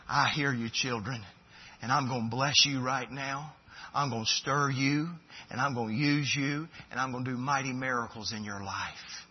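hushed, tender divine reassurance; tender voice of God. Acted. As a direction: speak low and reassuring like a loving father. Preacher voices God's answer.

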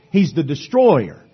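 ordinary talk

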